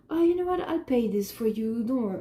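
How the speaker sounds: mock patronising guy. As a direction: parody a man grandly offering to pay. Mocking a guy offering to pay.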